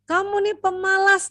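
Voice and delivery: put-on high, sharp scolding of a lazy child. Shown as a demonstration. Mother scolding her child, sharp high scold.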